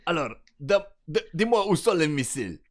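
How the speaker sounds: mock Russian accent from a dubbed film; imitated Russian accent. Co-host mimicking the film villain's interrogation, mock Russian accent. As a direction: put on a rough Russian accent. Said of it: "l'accent russe, moyen, hein".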